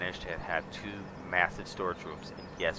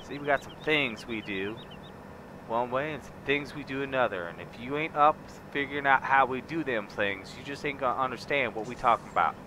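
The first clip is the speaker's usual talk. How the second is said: southern ranch talk; 3 semitones higher than usual. Acted. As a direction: lay on a big Southern accent, welcoming a partner. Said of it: "I could go all southern on you".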